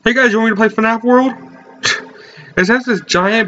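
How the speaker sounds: normal talking voice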